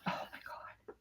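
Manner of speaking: an unvoiced whisper, breathed out. Real, not acted. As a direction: drop to a breathy aside, barely audible. Whispered aside mid-story.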